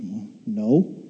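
low, soft acted concession; small, reluctant mock admission; sheepish admission. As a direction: give in meekly, voice dropped. Sheepishly conceding to God's challenge.

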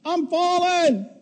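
high, slow, frantic wail; panicked cry. Retold or imitated. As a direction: cry out as the edge nears. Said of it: "He says".

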